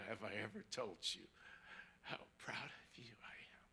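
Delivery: soft, low, hushed pride; tender father's whisper. Retold or imitated. Father's tender words retold again.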